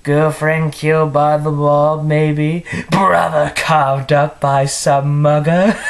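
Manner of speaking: mocking villain guessing game; stagey supervillain taunt, close to breaking into laughter; Mark Hamill Joker. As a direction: toss out cruel guesses in a theatrical voice. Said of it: "my Mark Hamill impression".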